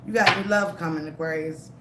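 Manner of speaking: normal talking voice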